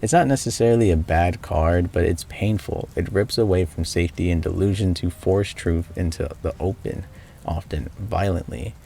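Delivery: steady recap narration voice; story narration. Acted. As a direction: narrate the game's ending evenly.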